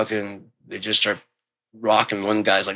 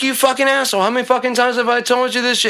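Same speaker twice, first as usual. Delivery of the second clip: high, fast furious yell at the cat; furious scold at cat; faster than usual. Retold or imitated. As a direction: chew out the cat, fed up. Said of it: "I'm saying, like".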